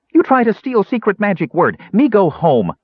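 high-pitched accusation; angry little dwarf. A narrator's reading. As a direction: huff it in an offended small-creature voice. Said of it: "Bupu demanded angrily".

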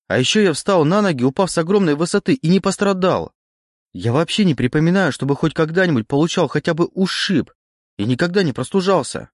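high, rapid, insistent reasoning; excited realisation. A narrator's reading. Oleg argues his case to the voice, excited.

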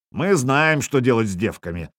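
plain reading voice

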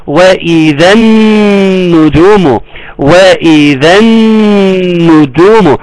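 slow recitation, long held doubled noon; tajweed demonstration. Shown as a demonstration. Teacher showing which letters drop, slow tajweed recitation. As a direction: hum through the noon as you recite slowly. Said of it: "عمل إيه؟ شال الذال".

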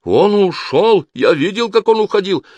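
high, loud, drawn-out protest; frantic high-pitched denial; desperate shouting. A narrator's reading. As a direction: plead innocence at a shout. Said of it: "закричал он".

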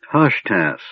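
plain reading voice

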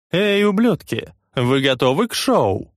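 loud high showman call; hype shout. Acted. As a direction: fire up the crowd. Leader hypes the crowd. (выкрик на толпу)

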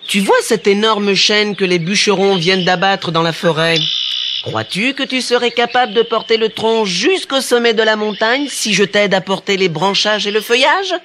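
high-pitched tailor posing a dare; challenging little tailor. A narrator's reading. Tailor sets the giant a challenge.

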